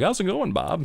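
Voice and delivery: high, light friendly voice; real voice. Retold or imitated. Drill instructor caught off duty, a high normal voice. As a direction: drop the act, chirp it casually. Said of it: "you catch them on the side".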